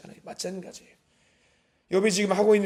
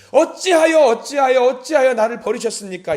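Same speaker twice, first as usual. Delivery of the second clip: 어찌하여 cried out much higher and louder, then easing; anguished outcry; 5 semitones higher, 8 dB louder than usual. Acted. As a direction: wail 어찌하여 like a dying man's cry. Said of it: "토로하셨냐면". (어찌하여를 절규하듯 높고 크게)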